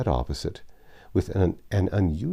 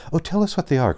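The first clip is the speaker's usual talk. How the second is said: much higher, louder eager plea; eager cry; 12 semitones higher, 5 dB louder, faster than usual. A narrator's reading. Meg begs her father to share his discoveries, crying out eagerly. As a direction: cry out, begging him to tell. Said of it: "cried Meg".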